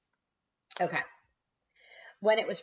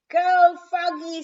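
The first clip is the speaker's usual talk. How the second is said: much louder, higher slow cheer; baby sister cheering; 12 semitones higher, 12 dB louder, slower than usual. A narrator's reading. Baby sister cheering Froggy from the stands. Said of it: "said Polly Woggalina".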